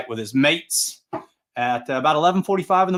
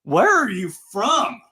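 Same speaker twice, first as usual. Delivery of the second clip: surprised; 9 semitones higher, slower than usual. Retold or imitated. Surprised announcer asks fan.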